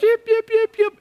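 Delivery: very high yipping like a kicked little dog; dog yelp imitation. Preacher imitating the kicked dog's yelp, very high yip. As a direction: squeal it like a small yelping dog. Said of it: "it yelps, it goes".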